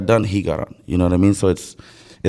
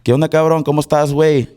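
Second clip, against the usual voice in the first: Mexican accent; 5 semitones higher than usual. Shown as a demonstration. Greeting a buddy in the demo, Mexican accent. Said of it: "And then Mexican".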